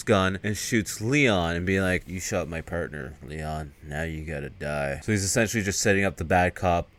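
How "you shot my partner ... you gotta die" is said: low, soft menace; mock cold threat. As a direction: imitate a bad cop quietly passing sentence. Bad cop's cold threat, acted.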